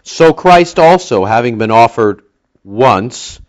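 slow, deliberate phrase landing on "once"; slow down and set "once" apart with weight; emphasis on ONCE. Real, not acted. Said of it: "with the emphasis on the word once".

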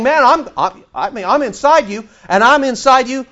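voicing the Holy Spirit. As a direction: speak as the Spirit urging from inside. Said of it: "He's saying".

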